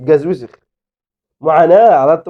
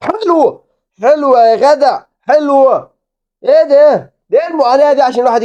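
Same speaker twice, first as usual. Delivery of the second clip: goal delight; 11 semitones higher than usual. Real, not acted. Gamer celebrating his team's goal. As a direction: cheer the goal in high voice.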